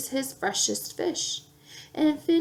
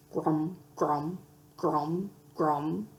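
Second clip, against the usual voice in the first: low, soft hippo chewing grum; hippo chewing gum; 7 semitones lower, 5 dB quieter, slower than usual. A narrator's reading. Reading to a child, a low chewing hippo. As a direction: chew it low like a big hippo. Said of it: "a hippopotamus chewing on gum".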